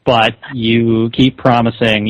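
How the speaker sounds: usual speaking voice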